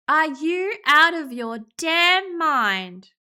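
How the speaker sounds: loud, slow, incredulous outburst; outraged. Acted. Player confronting Nate over the sacrifice, outraged.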